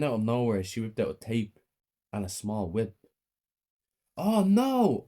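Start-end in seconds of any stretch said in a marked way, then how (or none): 4.2-5.0 high, loud cry of alarm; scream. A narrator's reading. Child running from mother with a whip, screaming. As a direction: cry out in panic, pitch way up. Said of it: "I screamed and ran".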